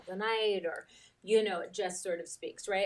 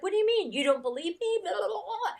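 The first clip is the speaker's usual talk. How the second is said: higher, mock-defensive outburst; feeling attacked; 10 semitones higher, 3 dB louder, faster than usual. [name] retelling answering her therapist, mock indignant. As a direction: bristle like you've been accused. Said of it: "I felt, like, attacked".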